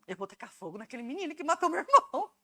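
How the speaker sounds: quoted through laughter, a bit fast; can't keep a straight face telling it; laughing retelling. Retold or imitated. Telling the church about her sister-in-law's plan, laughing. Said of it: "vocês vão rir agora".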